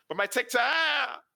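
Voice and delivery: high, loud, drawn-out whine; wailing protest. Acted. Meg protests being pulled away from TikTok, wailing. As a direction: wail it slowly as you get hauled off.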